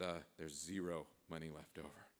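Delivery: low, quiet confession; sheepish admission. Acted. Envoy admitting to the president nothing is left, quiet and sheepish. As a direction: own up softly and reluctantly.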